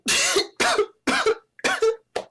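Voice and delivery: sudden loud, high hacking cough. Real, not acted. Reviewing jerseys on camera, he suddenly coughs loudly. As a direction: cough hard into the mic. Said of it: "Didn't mean to make that sound".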